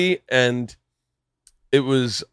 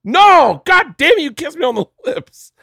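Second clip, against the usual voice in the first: high, loud, squealing protest getting louder; high mock outrage; 13 semitones higher, 8 dB louder, slower than usual. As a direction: play Kevin freaking out about the kiss, high and loud. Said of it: "He was like".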